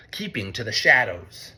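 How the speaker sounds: plain reading voice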